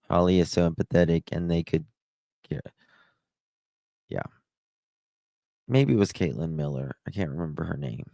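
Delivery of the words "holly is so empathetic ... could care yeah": ordinary talk, nothing marked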